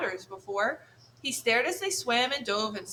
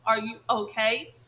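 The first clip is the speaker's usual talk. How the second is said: ordinary delivery, nothing marked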